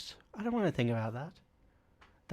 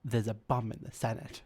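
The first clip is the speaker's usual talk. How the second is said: sultry villainess. Retold or imitated. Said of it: "villainous vixen of a woman".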